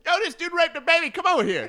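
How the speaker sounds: high, loud mocking shout; loud inmate yelling. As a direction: yell it across the yard.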